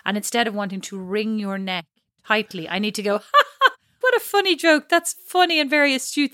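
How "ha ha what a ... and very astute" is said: forced good humour. Pretend. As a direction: fake high laugh masking rage. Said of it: "whole face has turned into a rictus mask of rage".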